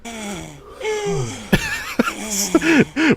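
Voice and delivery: high squealing sound effect; squeaky noise. Acted. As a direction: make a silly high squeal. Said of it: "Why are you making ... sound effect?".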